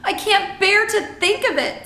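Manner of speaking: distressed, high and loud outcry; Sophie crying out. A narrator's reading. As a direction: cry out, unable to bear it. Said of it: "Sophie cried".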